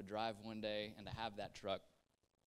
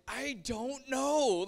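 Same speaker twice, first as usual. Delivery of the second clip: put-on much higher, louder, slow drawn-out kid voice; tearful kid; 12 semitones higher, 12 dB louder, much slower than usual. Boy answering his dad after the hunt, tearful and high. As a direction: sob it out like a confused boy.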